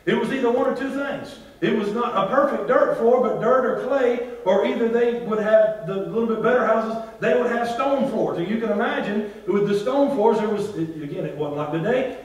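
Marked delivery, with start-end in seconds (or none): none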